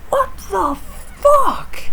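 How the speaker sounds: high, drawn-out wail of disbelief; anguished disbelief. Real, not acted. Fan stunned by the upset, wailing slowly. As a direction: cry it out, stretched and pained.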